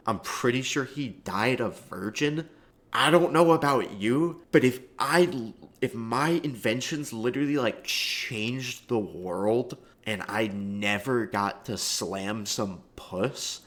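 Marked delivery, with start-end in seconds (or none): 3.0-7.6 higher, louder, worked-up riff; excited hypothetical. Real, not acted. Vlogger riffing on Tesla, worked up and loud. 7.6-13.6 very slow, drawn-out punchline; slowed emphasis. Real, not acted. Vlogger dragging out the punchline, very slow. Riff on Tesla.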